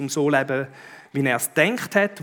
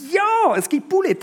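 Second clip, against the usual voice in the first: put-on high, bright burst of a child's delight; high excited girl's voice; excited child answer; 4 dB louder than usual. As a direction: squeal it like a happy little girl. Voicing the daughter's lunch answer.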